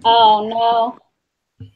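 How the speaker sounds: ordinary talk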